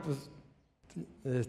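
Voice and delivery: usual speaking voice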